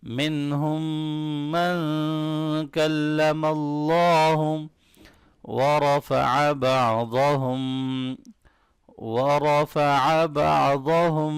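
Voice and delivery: slow chanted recitation; measured tajweed chant; Quran recitation. Shown as a demonstration. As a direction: recite it slowly, drawn out. Teacher recites verse portion.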